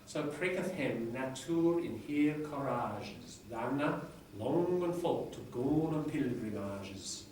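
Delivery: reading Chaucer aloud in Middle English pronunciation. Shown as a demonstration. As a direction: recite it like a lecturer reviving Chaucer's English. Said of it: "I can't pronounce it as well as my lecturer in Middle English, but I'll give it a go".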